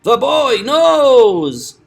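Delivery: high, loud cry stretching "knows"; slow, high-pitched wail on "knows"; elongated KNOWS. Shown as a demonstration. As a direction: drag "knows" out long and loud. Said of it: "to have that word be longer".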